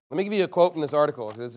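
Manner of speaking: usual speaking voice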